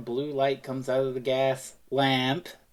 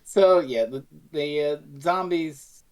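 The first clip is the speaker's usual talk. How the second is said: ordinary talk, nothing marked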